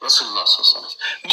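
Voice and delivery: usual speaking voice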